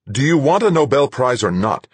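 high, loud impatient retort; snapped. Acted. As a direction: cut her off sharply. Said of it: "Duchamp snapped".

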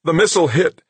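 high, loud alarmed report; urgent high-pitched announcement; ringing call. A narrator's reading. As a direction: ring it out across the room. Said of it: "a technician's voice rang out".

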